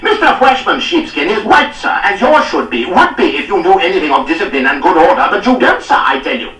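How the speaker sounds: high, loud gloating tirade; triumphant scolding. A narrator's reading. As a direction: crow over the captain as you scold. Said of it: "he turned in triumph on Reynolds".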